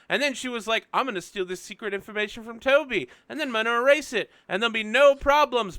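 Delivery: high, punchy mock-dramatic retelling with cartoonish quotes; hammed-up previously recap. Game master recapping last session to players, high and hammed-up. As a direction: race through the story, belting the characters' lines high.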